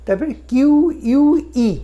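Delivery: normal talking voice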